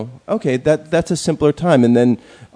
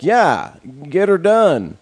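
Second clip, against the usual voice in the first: mock Southern accent; 8 semitones higher, slower than usual. Mocking the numbnuts who want a doer, in a Southern accent. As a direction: ham up a Southern accent, mocking Trump fans. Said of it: "I don't know why he has a Southern accent".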